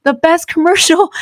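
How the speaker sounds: high excited telling husband; gushing to husband who missed it. Retold or imitated. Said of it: "I'm like".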